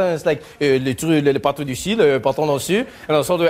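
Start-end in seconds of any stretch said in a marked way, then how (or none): 0.6-2.9 low mock-French mumbled chatter; lower-pitched casual French small talk; French people chatting. Imitating French friends hanging out with each other, chatting low in French. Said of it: "French people just hanging out with each other".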